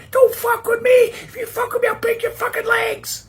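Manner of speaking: a dainty princess turning gangster; princess threat. Acted.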